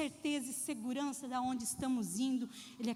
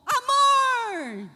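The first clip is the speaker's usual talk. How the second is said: much louder, higher yell; shout; 8 semitones higher, 11 dB louder than usual. Retold or imitated. Wife yells back at her husband, even louder. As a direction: bellow it back to top him. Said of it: "E ela gritou".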